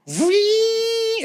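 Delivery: long, high-pitched detector whine; squealing "wee" like a ghost detector; high device squeal. Retold or imitated. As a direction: imitate a gadget going off with a sustained squeal. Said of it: "and it goes".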